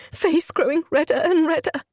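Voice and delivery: usual speaking voice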